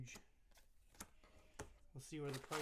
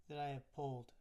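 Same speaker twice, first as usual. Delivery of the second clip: normal talking voice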